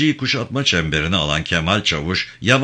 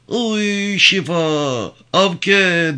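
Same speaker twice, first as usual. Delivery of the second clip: much higher, drawn-out wail of names; anguished cry; 11 semitones higher, 3 dB louder, much slower than usual. A narrator's reading. Yavan at the burned house, crying out desperately. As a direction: cry out for the lost women. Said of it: "diye bağırarak".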